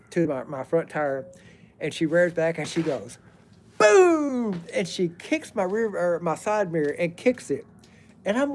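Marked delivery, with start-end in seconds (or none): 3.8-4.6 sudden high shouted boom; loud mock boom. Retelling her kick at the mirror, a loud boom. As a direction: belt out the kick sound.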